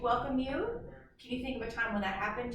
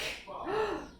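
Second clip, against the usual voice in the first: high sharp storytelling gasp; dramatic gasp; 11 semitones higher, 3 dB quieter than usual. Acted. Teacher gasping for the kids as the ice breaks, dramatic gasp. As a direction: gasp as if the ice just broke.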